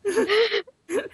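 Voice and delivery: shrieking giggle; high laugh burst. Real, not acted. Lip-reading game, funny wrong guess.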